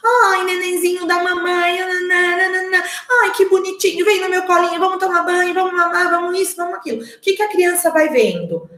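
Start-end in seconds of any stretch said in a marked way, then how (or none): 0.0-7.0 put-on high, singsong baby talk; gushing parent babbling sweet nothings; adult cooing to baby. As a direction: coo over a newborn in a sustained high pitch. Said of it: "vem aquele adulto com uma boca enorme e começa".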